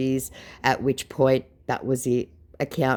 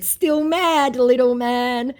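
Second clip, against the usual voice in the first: mock taunt; 11 semitones higher, 5 dB louder, much slower than usual. Taunting the scammer.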